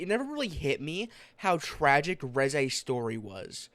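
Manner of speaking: normal talking voice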